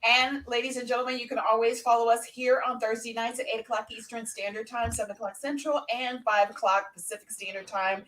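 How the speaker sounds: usual speaking voice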